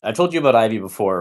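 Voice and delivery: ordinary delivery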